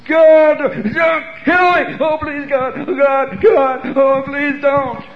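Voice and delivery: high, loud desperate pleading shout; terrified begging. Retold or imitated. Man begging God not to kill him, screaming terrified. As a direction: scream for mercy like a little baby. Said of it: "Like a little baby".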